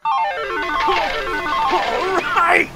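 high, loud jackpot cry; triumphant yell. Acted. Gambler hitting the jackpot, triumphant yell.

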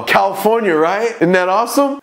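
high-pitched mock-friendly chirp; retold perky, teasing reply; cheeky chirp. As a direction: needle the cop with fake cheer.

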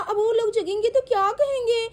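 ordinary delivery

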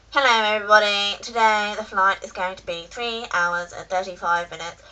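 droning mock cockpit announcement; airline captain. Captain announcing flight time to passengers, mock announcement voice. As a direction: imitate a captain on the tannoy, level and measured. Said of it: "the captain speaks and goes".

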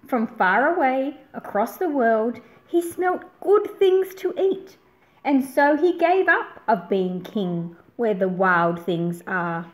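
plain reading voice

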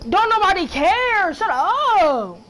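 loud, high, slow snap; angry snap. Real, not acted. Sibling yelling at crying brother to shut up, loud. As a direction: shut him up hard.

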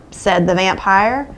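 plain reading voice

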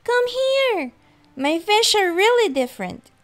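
high, loud, thrilled shouting; high-pitched excited child; excited yell. A narrator's reading. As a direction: call Mom over in amazement. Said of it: "I yelled with excitement".

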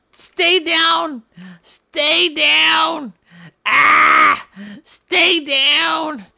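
loud mock struggle, pitch up; mock fight yelling. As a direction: yell at the attacker to stay down. Fighting off attacker.

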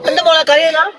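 teasing question, laughing while asking it, pitch up; laughing tease. Real, not acted. Father teases his crying daughter, laughing.

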